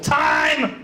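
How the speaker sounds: high, drawn-out mock yell. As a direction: imitate a heckler calling time. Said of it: "Julia yelled".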